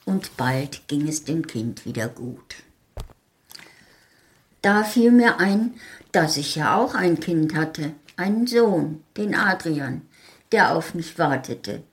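usual speaking voice

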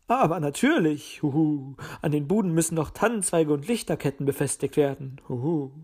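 lightly voiced elder owl; a hint of an owl's hoot; hooting uncle owl. A narrator's reading.

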